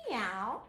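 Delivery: drawn-out meow rising at the end; imitated cat's meow; playful cat meow. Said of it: "Into cat".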